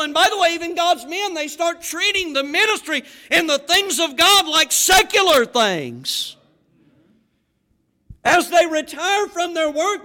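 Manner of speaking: loud, high, fervent pulpit preaching; preaching at full force. Acted. Preacher urging retirees to keep serving, loud fervent preaching. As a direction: drive it home to the congregation, full voice.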